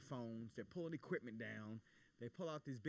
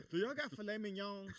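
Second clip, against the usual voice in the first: loud, high-pitched mock order; pushy passenger; 7 semitones higher, 6 dB louder than usual. Passenger asking flight attendants for steak mid-emergency, pestering. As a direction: call out to the cabin crew, hammed-up.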